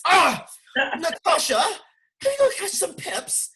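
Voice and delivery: high, loud hammed-up British voice; playing posh Londoner, badly on purpose; mock British accent. Trying a British accent for the London crowd, hammed up. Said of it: "I messed up with all the accents".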